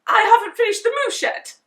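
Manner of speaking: high, loud, fast fluster; panicked. A narrator's reading. Dad flustered by early guests, fast and high. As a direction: babble it in a panic.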